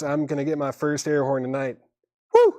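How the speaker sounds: ordinary delivery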